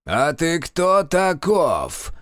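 slow, high, loud bark; barked challenge. Acted. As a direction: bark it out slowly like an old sorcerer. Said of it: "гаркнул Киловяз".

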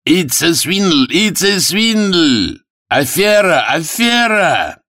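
high, drawn-out yells; crowd shouts. Acted. Spectators cheer and protest the runners, high shouting. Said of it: "Крики".

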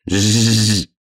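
teasing "zzz" imitation; mocking buzz. A narrator's reading. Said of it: "передразнил заяц".

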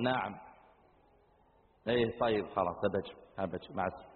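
low, soft brush-off; dismissive, grudging. Acted. Young man fobs off his mother's call, dismissive. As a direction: get rid of the caller curtly. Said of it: "وتكلم من طرف خشمك مع أمك".

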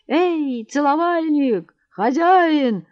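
high, drawn-out hail; calling out. A narrator's reading. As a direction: call for the landlord across the room. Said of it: "закричал Матвей Трофимыч".

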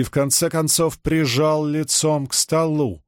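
usual speaking voice

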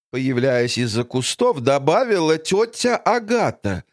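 ordinary delivery

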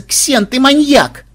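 high, slow, loud outcry; exasperated shout. Acted. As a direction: yell at him, appalled. Woman shouts at the inventor. (возмущённый вскрик)

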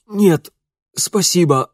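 hoarse. Acted. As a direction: croak it out hoarsely. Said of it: "прохрипел он".

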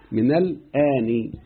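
من الآن drawn out very slowly; slow demonstration. Shown as a demonstration. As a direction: stretch every syllable of من الآن. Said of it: "أظن وصل كده؟". ("من الآن" بمدّ بطيء جدا)

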